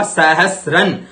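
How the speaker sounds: सहस्रन with स्र cluster distinct; clear sra. Shown as a demonstration. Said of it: "ये स्र". (सहस्रन में स्र साफ़)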